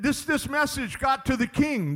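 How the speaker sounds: ordinary delivery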